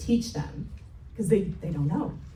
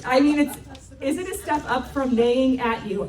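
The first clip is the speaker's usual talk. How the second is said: ordinary delivery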